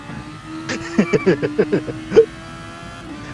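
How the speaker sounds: long breathy chuckle; real laugh running on for seconds. Real, not acted. Host amused by co-driver in the race, laughing.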